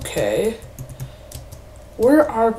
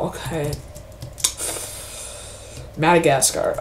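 normal talking voice

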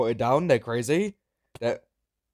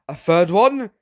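high, loud disbelieving yelp; can't believe the third one; squeaky surprised shout; shocked; 10 semitones higher, 13 dB louder than usual. Real, not acted. Another soul drops in game.

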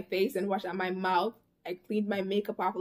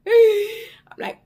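high laughing squeal; 13 semitones higher, 9 dB louder than usual. Real, not acted. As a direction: burst out laughing mid-line. Laughing burst mid story.

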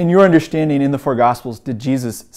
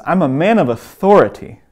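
authority pushed out louder and higher; "authority" given firm, raised emphasis; stress on authority; 4 semitones higher, 6 dB louder than usual. Retold or imitated. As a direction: lean on the middle of "authority". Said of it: "I love to emphasize this word too".

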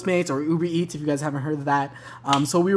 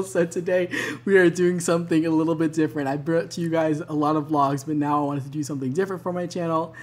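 ordinary delivery

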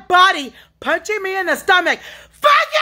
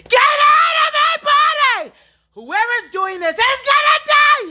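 real furious shout; 13 semitones higher than usual. Real, not acted. Ordering the spirits out, furious scream. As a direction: scream the order out of your body. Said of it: "This yelling and screaming".

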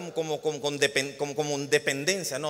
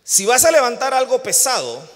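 "Si vas a levantar algo pesado" said higher and louder; emphatic louder take; 8 semitones higher, 12 dB louder than usual. Shown as a demonstration. Preacher calling out to the congregation, "Si vas a levantar algo pesado" higher and louder. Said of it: "todos los que están como yo".

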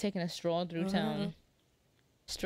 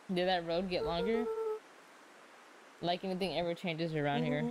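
normal talking voice

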